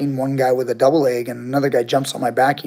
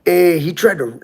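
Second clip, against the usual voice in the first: put-on a light Spanglish accent on the robber's words, a bit higher and louder; imitating Spanglish accent; 5 semitones higher, 5 dB louder than usual. Mugger bragging to his crew, in a light Spanglish accent. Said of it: "Spanish English, uh, accent".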